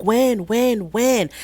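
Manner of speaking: high, insistent repeated question; imitating impatient demand. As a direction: mimic her pestering him for an answer. Retold impatient demand for an answer.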